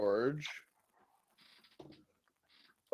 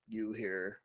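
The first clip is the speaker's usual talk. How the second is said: normal talking voice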